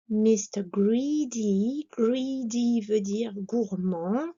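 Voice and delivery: usual speaking voice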